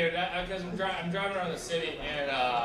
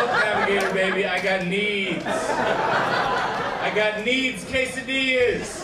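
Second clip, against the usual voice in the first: loud pleading with drawn-out words; mock impassioned plea; 4 semitones higher, 8 dB louder, much slower than usual. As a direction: argue back loudly, stretching each need. Argues back at the navigator.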